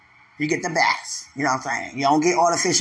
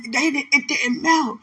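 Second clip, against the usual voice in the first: usual speaking voice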